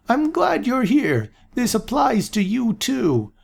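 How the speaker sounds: put-on high, slow, pleased old man; an absent-minded prophet welcoming guests; old seer voice. A narrator's reading. Aaron greeting Amanda, high slow old voice.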